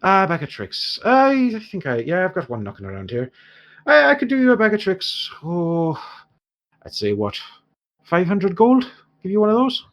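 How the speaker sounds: lightly Irish dwarf, lifted pitch; dwarf shopkeeper. Acted. Dwarf offers a bag of tricks, lightly voiced. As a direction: think up a price as a canny trader.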